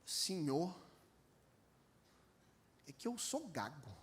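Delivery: low, soft, shy Moses voice; timid stammerer. Pretend. Moses pleading timidly with God, low and soft. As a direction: shrink away from God's call.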